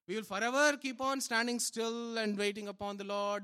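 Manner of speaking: ordinary talk, nothing marked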